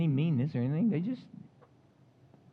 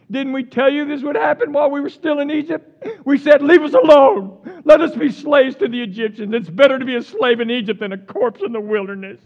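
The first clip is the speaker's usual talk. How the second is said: loud, higher whining crowd; griping Israelites; 7 semitones higher, 11 dB louder than usual. Retold or imitated. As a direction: play up the complaint. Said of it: "And they said to Moses".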